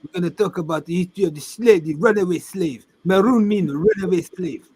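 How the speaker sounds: poor imitation of African accent. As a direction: imitate a man with a French-sounding African accent. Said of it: "my poor imitation of African".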